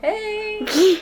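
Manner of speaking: calling out. Real, not acted. Calling out in cave.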